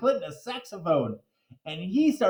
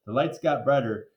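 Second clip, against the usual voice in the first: plain reading voice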